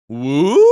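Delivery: questioning woo with rising end; a small, unsure imitation cheer; tentative woo. Co-captain who speaks no English, uncertain questioning woo. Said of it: "And she just goes".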